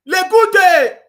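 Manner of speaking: high, loud laughing Lekute; mocking laughter. Real, not acted. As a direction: laugh the name out in ridicule. Mocks the name Lekute.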